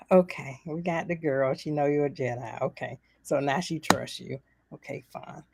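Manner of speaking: resigned. Retold or imitated. Viewer calming down after child's reveal, resigned muttering.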